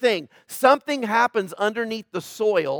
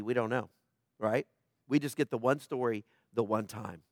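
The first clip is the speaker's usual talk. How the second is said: quiet aside; 9 semitones lower, 11 dB quieter than usual. Real, not acted.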